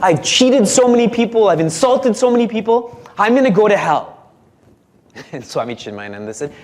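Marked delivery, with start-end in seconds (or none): 0.0-4.2 panicked disciple. Acted. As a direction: list your sins in a rush of dread.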